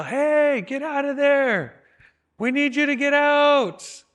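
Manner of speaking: high, loud, drawn-out yell of warning. Retold or imitated. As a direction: call out loud to warn him off. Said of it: "he starts to yell".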